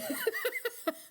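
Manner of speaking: high burst of laughter; a real laugh that falls away. Real, not acted. Laughs at own answer.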